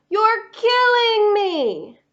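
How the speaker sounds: hammed-up despair; mock anguish. Gray Crayon groaning to Duncan, mock anguish.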